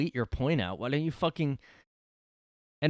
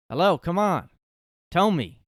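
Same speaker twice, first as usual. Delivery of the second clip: higher, louder mock demand; retold yell; 8 semitones higher, 9 dB louder than usual. Older New Yorker demanding an answer from riders, loud. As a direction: call it out, fed up and insistent. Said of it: "yelled at people that weren't answering".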